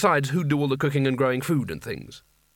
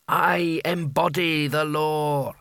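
extremely slow, grand declaration; the hat's voice; 3 semitones higher, much slower than usual. A narrator's reading. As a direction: pronounce it as an all-powerful magical hat. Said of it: "he said in the hat's voice".

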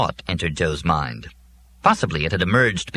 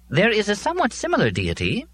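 a pedantic robot answering; 14 semitones higher than usual. A narrator's reading. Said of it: "robot resumes".

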